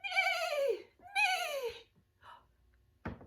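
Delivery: very high neighing whinny; whinny like a horse. Shown as a demonstration. Teacher neighing like a horse for kids.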